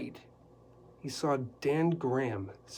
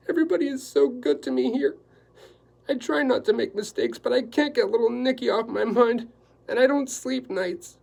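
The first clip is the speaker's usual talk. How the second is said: a high, fast, tearful housekeeper; 10 semitones higher, 8 dB louder than usual. Acted. Housekeeper confessing to Quillerin she can't sleep, tearful. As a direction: let the tears well up as she talks. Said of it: "Tears came to her dull eyes".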